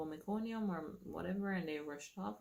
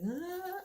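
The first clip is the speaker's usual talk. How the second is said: high sharp cry of labor pain; retold cry of pain, much higher; 14 semitones higher, 4 dB louder than usual. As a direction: cry out as a contraction hits. Contraction hits, mother heard downstairs.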